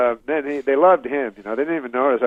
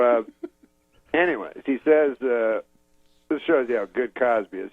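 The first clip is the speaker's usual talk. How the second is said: normal talking voice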